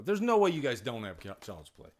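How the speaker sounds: ordinary talk, nothing marked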